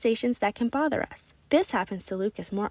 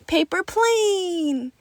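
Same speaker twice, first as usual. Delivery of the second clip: high and very slow, stretched out gladly; excited child; 8 semitones higher, much slower than usual. A narrator's reading. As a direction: announce it like a treasure. Lucas answers excitedly.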